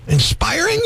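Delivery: INSPIRING as a high rising question; incredulous echo. Acted. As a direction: throw the word back in disbelief. Host echoes her word in disbelief.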